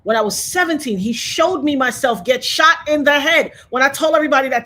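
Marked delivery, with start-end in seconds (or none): none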